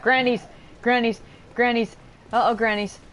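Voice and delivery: loud, high panicked shouts; panicked yelling. Real, not acted. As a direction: yell in sudden fright. Grannies suddenly attack.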